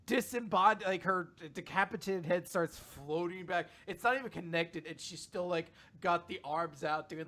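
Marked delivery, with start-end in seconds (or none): none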